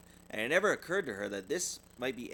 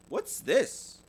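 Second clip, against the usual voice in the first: high, louder puzzled question; curious child; 10 semitones higher, 5 dB louder than usual. Acted. Sister asking about the words in her locket, high and curious. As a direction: ask it wide-eyed. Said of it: "she asked".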